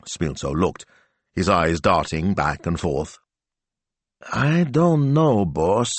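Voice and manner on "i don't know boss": higher, slow and hesitant; slowly. A narrator's reading. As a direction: drag it out, unsure. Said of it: "he said slowly".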